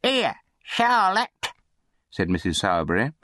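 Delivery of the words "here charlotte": high, drawn-out summons; undertaker's wife calling. A narrator's reading. Mistress calls down to the servant girl, high drawn-out summons.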